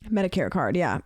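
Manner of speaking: ordinary talk, nothing marked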